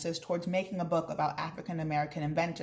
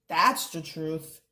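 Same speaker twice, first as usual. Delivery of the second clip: slow knowing agreement; 3 dB louder, much slower than usual. Acted. Mama agrees with worker.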